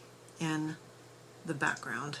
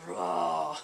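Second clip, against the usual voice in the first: mock griffin roar. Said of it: "Maybe they go".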